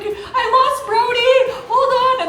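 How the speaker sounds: very high, loud, slow frantic mom; half-laughing replay of a frantic mother; panic mom mode. Retold or imitated. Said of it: "in full panic mom mode".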